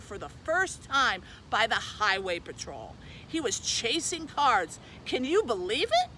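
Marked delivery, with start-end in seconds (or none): none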